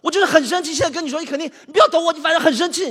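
loud, high, exasperated rant; angry venting. Acted. Venting his anger at Gouzi, loud and high. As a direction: vent it at full volume.

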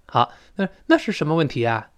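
ordinary delivery

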